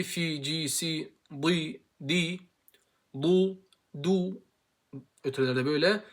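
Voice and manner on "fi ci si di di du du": "Fi, ci, si, di" y luego "du"; kasra vs damma drills. Shown as a demonstration. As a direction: recita i y luego u. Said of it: "Kesralar hep aynı".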